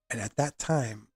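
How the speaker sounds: ordinary delivery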